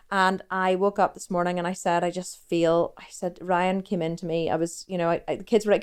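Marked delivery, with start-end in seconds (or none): none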